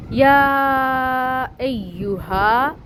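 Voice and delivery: slow chanted یا ایھا; recited phrase. Acted. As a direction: sing یا ایھا out slowly. Recites verse during translation.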